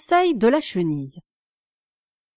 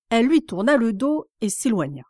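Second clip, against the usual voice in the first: ordinary delivery, nothing marked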